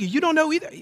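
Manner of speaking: imitating a frustrated rebuke; mild exasperation. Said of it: "sense his frustration".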